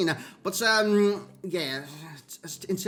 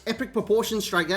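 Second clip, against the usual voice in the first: ordinary delivery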